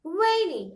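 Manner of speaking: loud "Rainy" with RAI held up, then falling; stress on first syllable. Shown as a demonstration. Teacher models a third example, "Rainy" stressed on rai. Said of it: "stress on the syllable number one".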